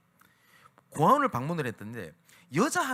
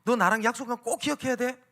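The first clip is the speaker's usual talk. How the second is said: higher and louder, a deliberately public call; calling out for all to hear; 8 semitones higher, 7 dB louder than usual. Retold or imitated. Pastor calling to the girl so other orphans hear, loud. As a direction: raise it so the other kids overhear. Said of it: "일부러 들으란 듯이".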